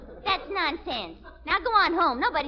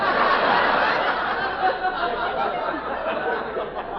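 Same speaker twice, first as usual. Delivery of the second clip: laughter. Real, not acted. Audience laughing at joke.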